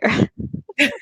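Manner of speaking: short high burst of laughter. Real, not acted. Laughing at her own Aragorn joke, high. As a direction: laugh up at your own line.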